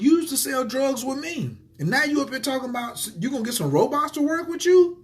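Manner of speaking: put-on high-pitched disbelieving challenge to an old buddy; incredulous retort. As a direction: call him out, voice climbing in disbelief.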